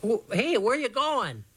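high-pitched startled question; calling after someone. A narrator's reading. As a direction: call out, puzzled, to a friend running off. Gang calls after the running boy.

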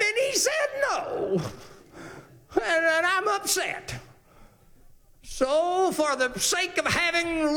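high, whining, complaining voice; whiny husband. Acted. Sulking king whining to his wife about the vineyard. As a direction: whine it like a spoiled child.

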